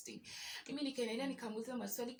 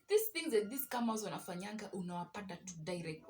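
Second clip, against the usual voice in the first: usual speaking voice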